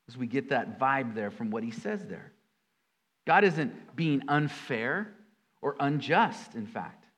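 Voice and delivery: usual speaking voice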